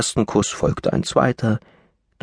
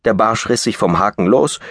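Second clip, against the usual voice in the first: ordinary delivery, nothing marked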